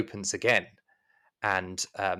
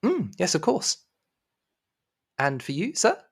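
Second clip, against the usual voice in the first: high, polite maid voice; high-pitched courteous servant; attentive maid; 8 semitones higher than usual. Acted. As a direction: take a guest's order in high servile tones. Game master plays the maid.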